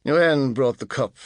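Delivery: ordinary delivery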